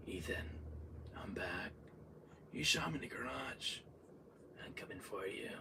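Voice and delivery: low, hushed villain voice; very soft, menacing whisper; creepy taunt. Retold or imitated. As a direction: murmur it like a stalker closing in. Villain taunting lines recalled.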